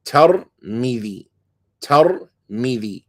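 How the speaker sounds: Tirmidhi said slowly with an open a after the T and an i on the m; fatha on the ta. Shown as a demonstration. Teacher showing how the townspeople say it, slow and careful. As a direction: draw out Tirmidhi, tar-MI-dhi, slowly. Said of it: "a fatha on the ta and a kasra on the meme".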